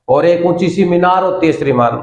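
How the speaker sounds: ordinary talk, nothing marked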